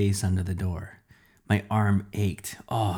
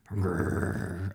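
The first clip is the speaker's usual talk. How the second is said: low growling snarl; low dog growl; 3 semitones lower, 6 dB quieter than usual. Acted.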